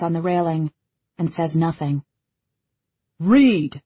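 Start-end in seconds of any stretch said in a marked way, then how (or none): 3.2-3.8 loud commanding call; Callum's commanding voice. A narrator's reading. Father summoning his son from the railing, commanding. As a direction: call the name out like an order. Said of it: "Callum's commanding voice bounces off the walls".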